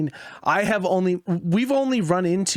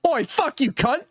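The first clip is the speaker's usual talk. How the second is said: higher-pitched imitation of a British retort; mock British accent; 9 semitones higher than usual. Imitating a player told he sounds British, mock British accent. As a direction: put on a cheeky British accent. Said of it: "like, an accent or whatever".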